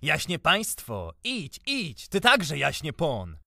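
high, drawn-out scornful jeer; bitter jeering. A narrator's reading. Drunk, bitter taunting of the gentry.

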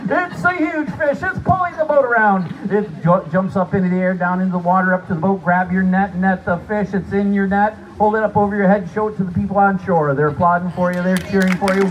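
building to a high, loud climax; excited. Acted. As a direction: whip them into excitement. Excited fishing narration.

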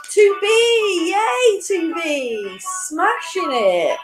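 high, drawn-out whoop for the winner; excited cheer. Real, not acted. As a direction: celebrate with a long, high cheer. Cheering the fastest player.

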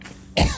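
sharp unvoiced cough; coughing on a bug. Real, not acted. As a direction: cough like something's in your throat. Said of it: "I just swallowed a bug".

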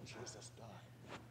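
sad hushed whisper, almost no voice. Acted. Disciples tell the stranger, a grieving whisper. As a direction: breathe it out like grieving news.

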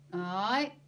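short, loud, grudging grunt; curt mumbled-assent grunt. A narrator's reading. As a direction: grunt a reluctant yes. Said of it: "grunted Ernie again".

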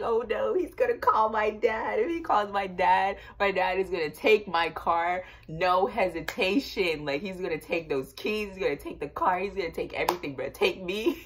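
teen panicking that dad will take the car, mock-frantic; retold panic. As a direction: spiral into comic dread about dad. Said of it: "I was like".

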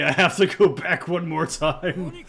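hammed-up desperate request; urgent plea. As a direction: blurt out the request loudly, in a rush to get back. Acted urgent plea for money.

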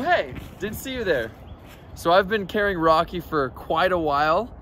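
ordinary delivery

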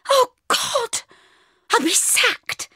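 very high, squeaky despair; panicked wail. Acted. Bridget realising she will be sacked, panicked wail. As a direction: wail in rising horror. Fears losing her job.

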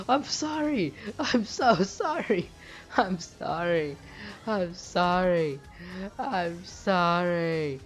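high loud wails slowing and fading down; hammed-up apology. Player wailing sorry to the deer he shot, mock grief fading. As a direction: overdo the sorrow, then let it die away slow.

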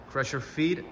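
ordinary delivery

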